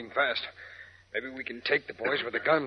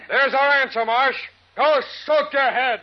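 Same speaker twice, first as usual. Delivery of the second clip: defiant shout; 14 semitones higher, 11 dB louder, slower than usual. Acted. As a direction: yell it high and loud, last part slow. Defiant yell through the door.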